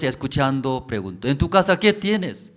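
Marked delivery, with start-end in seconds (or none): 1.3-2.5 higher, louder probing question. Acted. Prophet asking the widow what is in her house, loud and high. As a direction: demand to know what she has.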